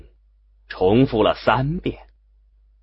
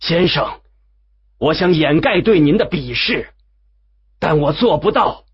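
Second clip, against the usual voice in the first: raised, higher-pitched declaration; loud call; 6 semitones higher, 4 dB louder than usual. A narrator's reading. Hines calling after Wu Yue from the steps, loudly. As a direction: call it out firmly to someone walking away. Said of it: "西恩斯在台阶上对着已经走进信念碑阴影中的吴越大声说".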